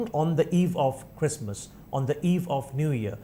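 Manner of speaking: slow, careful example phrases. Shown as a demonstration. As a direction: say each example slowly. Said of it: "on the eve মানে কিন্তু অন্য".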